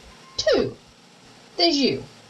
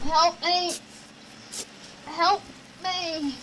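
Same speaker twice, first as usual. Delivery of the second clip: higher, drawn-out cry for help from sleep; nightmare plea; 4 semitones higher, 6 dB quieter, much slower than usual. Acted. Girl asleep in a nightmare, pleading for help. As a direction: plead from inside a bad dream.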